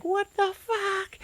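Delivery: very high, slow cartoon outrage; tiny chips, mock outraged; squeaky outrage. Chips getting cheese grated.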